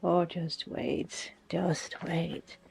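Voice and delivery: slow, very soft, dropping to a whisper; hushed whisper. Real, not acted. Owner to sleeping Moon before the surprise, hushed whisper. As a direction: whisper it low and slowly.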